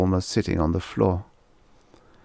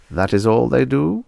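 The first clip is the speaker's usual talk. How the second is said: light German doctor voice; German accent; 6 semitones higher, 4 dB louder than usual. Acted. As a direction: ask like a curious German shrink. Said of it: "a German accent".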